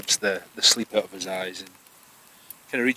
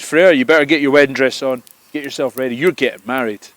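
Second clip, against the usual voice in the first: loud announcement; 4 semitones higher, 10 dB louder than usual. Acted. Loki announces the wedding to Freya.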